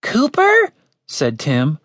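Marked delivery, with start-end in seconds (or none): 0.0-0.7 very high, squeaky question. A narrator's reading. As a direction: pipe it up in startled confusion. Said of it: "Why was his voice so high?".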